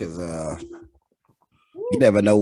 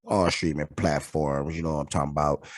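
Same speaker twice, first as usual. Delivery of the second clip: ordinary talk, nothing marked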